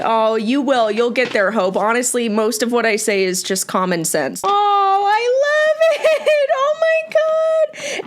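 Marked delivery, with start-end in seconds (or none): 4.4-8.1 very high, stretched-out squeal; delighted squeal. Real, not acted. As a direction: squeal with joy, climbing sky-high. Thrilled by fan's drawing.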